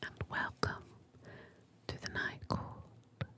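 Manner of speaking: soft unvoiced whisper, close to the mic; ASMR whisper. Real, not acted. Host whispering to a sleepy listener, ASMR whisper. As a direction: breathe every word like a bedtime secret.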